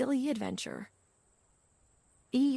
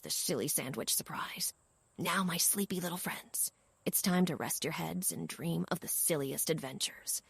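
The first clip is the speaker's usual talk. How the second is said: softer, whispering the story to its close; bedtime whisper; 4 semitones lower, 6 dB quieter than usual. A narrator's reading. Storyteller settling sleepy children, soft whisper. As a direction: hush them toward sleep. Lulling the little ones to sleep.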